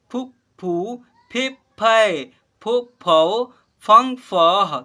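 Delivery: slow, loud, drawn-out syllable recitation; syllable drill. Shown as a demonstration. Teacher leads class in reciting barahkhadi, slow and loud. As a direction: call out each syllable clearly for the class to repeat. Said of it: "यहाँ पर देखिएगा".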